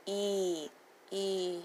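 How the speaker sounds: "E" held out, softly; a drawn-out, separate EE for each "E"; long E sound. Shown as a demonstration. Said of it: "You can hear that".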